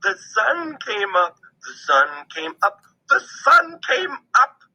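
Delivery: high little squirrel chant, repeated; a small squirrel singing the same line; chirping squirrel. A narrator's reading. Squirrel chirping the sunrise over and over.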